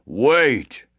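stern giant growl. Acted. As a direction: bark one word. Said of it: "growled the Giant".

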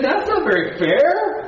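indignant skeptic. Retold or imitated. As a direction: scoff at the unfairness, half laughing. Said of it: "Some of you said".

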